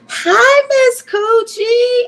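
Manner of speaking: high, drawn-out squeal of hello; delighted high-pitched hello to a viewer; excited greeting. Real, not acted. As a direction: call her name out high and slow.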